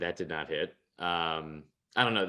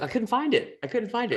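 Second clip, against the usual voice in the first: high, strained wail of frustration; exasperated whine; 14 semitones higher, 4 dB louder than usual. Real, not acted. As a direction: whine it like you can't believe it. Frustrated over missed bet.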